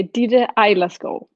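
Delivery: "Eilerskov" as eye-las-ko, final v silent; name pronunciation demo. Shown as a demonstration. Artist teaching host her name, "Eilerskov" said eye-las-ko. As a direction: show how "Eilerskov" goes: EYE-las-ko. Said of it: "how do we pronounce your name".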